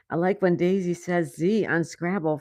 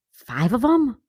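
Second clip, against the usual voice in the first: gasping, slow, raised disbelief; shocked gasp; 4 semitones higher, 4 dB louder, slower than usual. Real, not acted. Hearing five bison escaped, gasping in shock. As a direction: catch your breath at the news.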